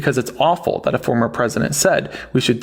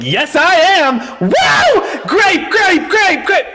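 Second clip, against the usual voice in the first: hammed-up, high, loud cheering with a laugh; mock cheering; 5 dB louder, much slower than usual. Mocking a caller's loud cheer of yes and grape. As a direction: whoop it up like an overexcited fan. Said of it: "It's a little weird".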